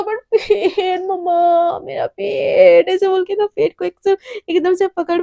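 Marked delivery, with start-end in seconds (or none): 0.0-2.9 slow, high wailing whine; crying over stomach. Acted. Hungry girl crying to her mother about her stomach, slow wail. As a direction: drag it out in a mock cry. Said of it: "एकदम रो रही होती है".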